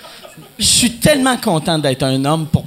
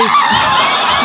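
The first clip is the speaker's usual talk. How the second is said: bursting out laughing; big shouted laugh; loud laugh; 4 semitones higher, 5 dB louder than usual. Real, not acted. Loud laughing at own joke.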